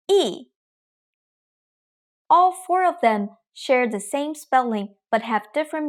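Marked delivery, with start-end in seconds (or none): 0.1-0.4 "E" falling sharply; fourth tone E. Shown as a demonstration. Teacher demonstrates falling tone on E. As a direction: drop "E" straight down. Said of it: "E, sounds like with four different tones".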